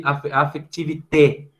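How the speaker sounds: "affectivité" tried in French, slowly, after a false start, stress on the final té; French pronunciation. Shown as a demonstration. Teacher unsure of the French word, tentative French pronunciation. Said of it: "mas é mais ou menos assim".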